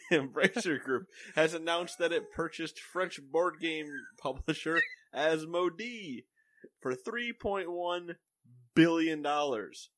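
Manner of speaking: slow, high, laughing news read; laughing while reading. Real, not acted. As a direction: fight back laughter as you read.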